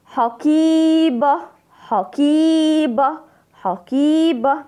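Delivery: "حقيبة" slow and high, with a long ee in the middle; stretched long vowel. Shown as a demonstration. Teacher showing pupils the madd, sustained ee in "حقيبة". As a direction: drag out the ya in "حقيبة". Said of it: "مد بالياء".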